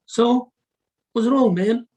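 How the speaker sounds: loud, high, brash chat with a buddy; casual loud talk. Shown as a demonstration. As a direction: talk like shouting to a friend. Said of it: "If you speak with your friend".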